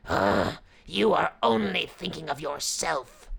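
scornful hungry tiger. A narrator's reading. Tiger rebuking the Brahmin, scornful and loud. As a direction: scoff roughly as the tiger. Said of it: "said the hungry tiger".

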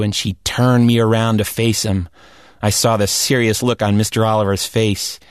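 usual speaking voice

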